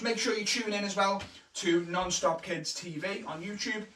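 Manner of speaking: ordinary talk, nothing marked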